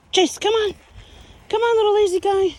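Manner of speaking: high, loud coaxing; coaxing call. Real, not acted. Owner coaxing lazy Chase, high and loud. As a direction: coax the lazy pup along.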